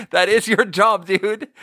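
high, amused protest; laughing scold. Real, not acted. Telling off a slacking coworker, laughing exasperation.

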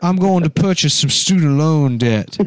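teasing impression of a genteel Southern dandy; mock Southern dandy voice. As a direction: draw it out like a Southern gentleman. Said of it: "My cool Southern dandy voice".

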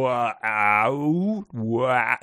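WOW stretched into slow separate sounds, "wuh-owww"; mock phonics-lesson "wow", each sound drawn out. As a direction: sound out "wow" slowly, sliding from one vowel to the next. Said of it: "like they were doing a phonics lesson".

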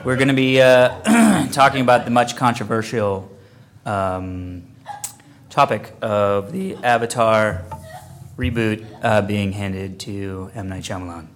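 loud, low announcer voice; host a con panel, booming; panel moderator voice. Acted.

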